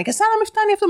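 high-pitched indignant mother, acted out. Mother scolding the vendor inside his van, furious retelling. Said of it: "με φωνή τώρα αυτά".

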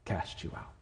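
Jesus reassuring the exhausted, in a soft whisper; whispered promise. Retold or imitated. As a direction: breathe the promise, barely voiced. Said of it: "And Jesus is saying".

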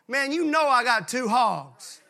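slow, emphatic comic protest; mock indignation. Clyde refusing Earl Ray his hogs, mock indignation. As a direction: balk at it, drawing out the words. Joke punchline, Clyde balks.

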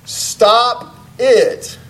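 high, loud, drawn-out yell; yelled command. Acted. Counselor repeating his advice to a baffled client, yelled. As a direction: shout each word with force. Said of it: "he yells".

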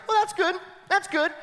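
a hint of a cheerful courtier; cheery advisor. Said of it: "the advisor goes".

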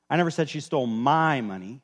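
"my" stretched and leaned on; stress on MY. Shown as a demonstration. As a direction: make "my" the word that stands out. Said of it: "emphasize a different word every time".

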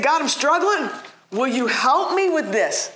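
high-pitched acted prayer; pleading. Struggling believer asking God for help, pleading.

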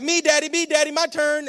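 put-on high, loud child's plea; eager young son. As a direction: imitate an excited little boy begging for a turn. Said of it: "my son would be like".

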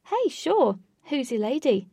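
bright Katie. A narrator's reading. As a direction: greet them with delight. Katie greeting.

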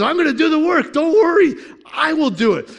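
high, loud, ringing reassurance; emphatic divine promise. Retold or imitated. Preacher voicing God to the congregation, loud reassuring shout.

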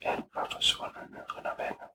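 hushed whisper, barely voiced. Shown as a demonstration. Storyteller whispering to the child how all vanished. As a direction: breathe it out as if everything went still. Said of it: "der var det helt stille".

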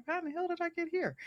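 mock bewilderment. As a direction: replay the moment it hit you, squeaky and baffled. Retelling her own confused thought.

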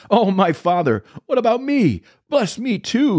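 very high, anguished pleading; bitter cry. A narrator's reading. Esau begs his father for a blessing, crying out bitterly. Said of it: "he let out a loud and bitter cry".

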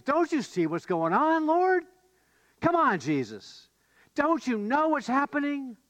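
high-pitched, exasperated pleading; acted panic. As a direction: protest like frightened disciples begging for help. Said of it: "So in their panic".

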